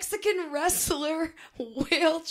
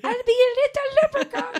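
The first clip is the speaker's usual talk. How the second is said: high, loud little leprechaun; squeaky hammed-up leprechaun; 11 semitones higher, 6 dB louder, faster than usual. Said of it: "Is that how it sounds?".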